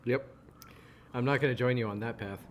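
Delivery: ordinary delivery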